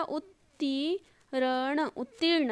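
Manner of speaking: उत्तीर्ण very slowly, "ut-tee-ra-na"; soft, drawn-out syllables in उत्तीर्ण; sounded out. Shown as a demonstration. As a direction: pull उत्तीर्ण apart sound by sound. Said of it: "इसमें कैसे होगा".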